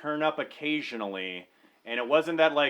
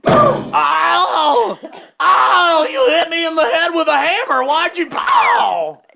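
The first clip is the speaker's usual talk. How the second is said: very high, loud yelps of pain; hammed-up pain; 14 semitones higher, 12 dB louder, slower than usual. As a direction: yowl like the hammer just landed. Traveler hit with hammer.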